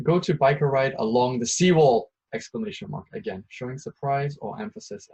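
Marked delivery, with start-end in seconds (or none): 0.1-2.1 louder, firm order; loud command. Shown as a demonstration. Teacher demonstrating a command with an exclamation mark, loud. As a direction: tell them what to do, loud and firm. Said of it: "to give a command".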